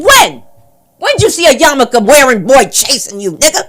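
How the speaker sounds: high, loud disbelieving shout; incredulous challenge. Acted. Comic confronting the kid in the street, incredulous challenge. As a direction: yell the question in disbelief. Acted street argument being retold.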